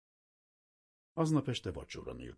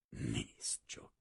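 soft whispered aside, lower; inner thought; 7 semitones lower, 7 dB quieter than usual. A narrator's reading. Kazimír thinking to himself about the food, whispered. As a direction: think it to yourself softly.

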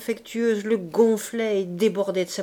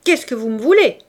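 loud, anguished angry demand; exclaimed in wounded rage; pained anger; 3 semitones higher, 9 dB louder than usual. A narrator's reading. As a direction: cry it out, hurt and furious. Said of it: "avec une douleur poignante et pleine de colère".

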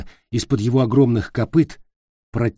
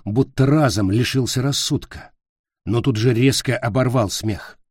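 ordinary delivery, nothing marked

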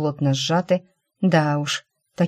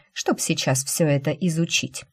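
usual speaking voice

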